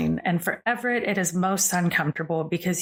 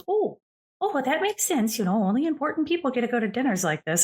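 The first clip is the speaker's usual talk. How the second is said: higher-pitched, quick granny voice; granny character; 6 semitones higher than usual. Acted. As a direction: play a chatty granny reacting in a high voice. Game master voices Granny Tia.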